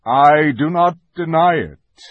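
lightly acted stately lion, firm; lightly voiced lion. A narrator's reading. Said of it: "said Aslan".

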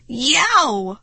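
loud high yelp; startled shriek. A narrator's reading. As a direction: yelp in shock. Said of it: "I let out a shriek".

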